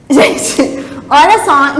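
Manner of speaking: ordinary talk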